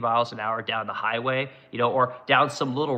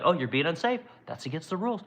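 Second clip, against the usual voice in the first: lightly voiced high nagging car; a hint of a prissy scold; mock nanny car; 6 semitones higher than usual. Mocking his Tesla's nagging warnings, high and whiny. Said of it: "constantly pinging me like".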